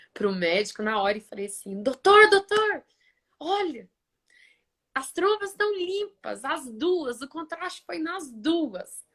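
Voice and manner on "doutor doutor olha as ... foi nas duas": high, urgent burst, loud on the first cry; retold excited call. Patient calls her doctor about clear tubes, excited. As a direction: re-enact breaking great news, pitched well up.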